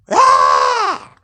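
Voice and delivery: high piercing scream from a woman; woman's scream. Retold or imitated. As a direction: let out a sudden high shriek of terror. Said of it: "they heard a woman screaming".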